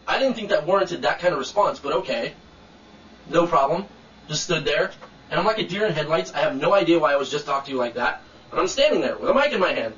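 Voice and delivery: ordinary talk, nothing marked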